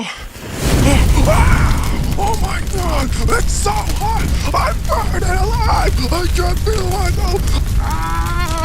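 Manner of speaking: high, loud, terrified screaming; panicked shrieks, begging for mercy; burning alive. Acted. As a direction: scream in agony as the heat takes you. Reporter burning alive on air.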